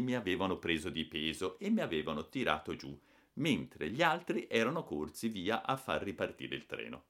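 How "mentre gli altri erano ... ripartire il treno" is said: ordinary delivery, nothing marked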